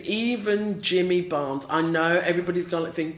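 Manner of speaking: normal talking voice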